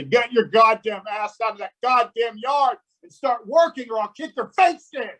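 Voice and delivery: put-on high, forceful yell at the kid; stepfather yelling. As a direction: bark it like the raging stepfather. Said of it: "he'd throw open the door ... and say".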